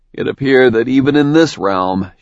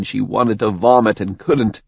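ordinary delivery, nothing marked

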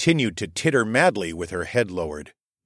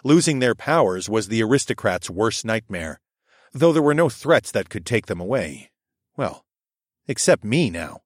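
ordinary delivery, nothing marked

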